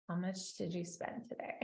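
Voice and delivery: hushed, suspicious spouse question; suspicious whisper. Retold or imitated. Spouse quietly asking how much was spent, in a whisper. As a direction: whisper it like a wary spouse checking up.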